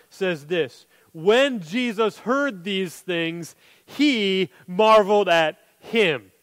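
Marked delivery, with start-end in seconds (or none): 1.1-6.3 very slow, weighing every word; slow deliberate reading. Shown as a demonstration. Preacher pointing the congregation to verse nine, slow and deliberate. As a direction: read it out as something to savour. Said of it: "Notice verse nine".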